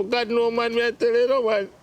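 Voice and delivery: ordinary delivery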